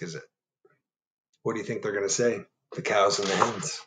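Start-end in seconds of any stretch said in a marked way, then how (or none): none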